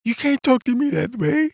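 hammed-up offended gasp of a reply; mock offended. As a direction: play an outraged, touchy listener. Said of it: "They're like".

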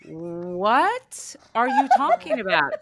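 loud, slow bewildered question; baffled mom. Retold or imitated. Mom confronted by daughter, loud slow bewilderment. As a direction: play it dumbfounded. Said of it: "I was like".

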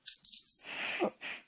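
soft wheezing laugh, almost no voice; breathy silent laugh. Real, not acted. Guest laughing at the host's hint about his wife, silent wheeze laugh. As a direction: let a silent laugh shake out.

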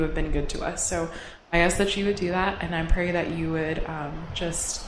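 soft, sincere prayer voice. Acted. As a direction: pray quietly and earnestly. Leads closing prayer.

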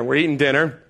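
ordinary talk

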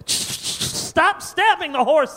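high, slow, pleading dad; exasperated dad. Acted. A worn-out rich dad pleading with his son, high and slow. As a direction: beg the kid to stop, drawn out. Dad scolding spoiled kid.

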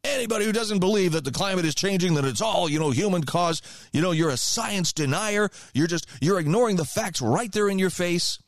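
a hint of a preachy radio host; imitating adamant host. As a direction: parody a true believer scolding a doubter. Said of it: "was just adamant that".

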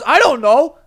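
loud, high comic outburst; retold mom yelling her admission; exasperated mother. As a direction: blurt it loudly, voice up. Angry mother also forgot the name.

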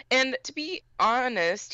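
ordinary delivery

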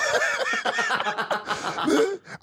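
high-pitched burst of laughter; long, high laugh. Real, not acted. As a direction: crack up mid-answer. Laughing at own blunt answer.

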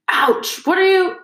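high, indignant cry of protest; pained yelp. A narrator's reading. Ron hit on the hand by Harry, pained yelp. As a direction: yelp at a sudden whack, voice jumping up.

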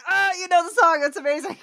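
loud, high fangirl gasp and gush; re-enacted thrilled outburst; retold excitement. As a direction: squeal it in delighted amazement. Retells excited reaction to boss singing.

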